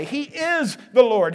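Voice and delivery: ordinary delivery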